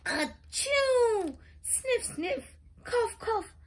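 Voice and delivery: high mock sneeze, sniffs and coughs; high, sniffly cold noises; sneezing and sniffling. A narrator's reading. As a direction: act out a cold with big sneezes. Sick pony sneezing and coughing.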